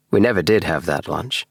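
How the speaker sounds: usual speaking voice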